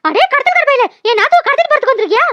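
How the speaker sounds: high-pitched, indignant confrontation; fierce challenge. Acted. Sister-in-law confronts supposed kidnapper, high indignant challenge. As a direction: defy the intruder outright.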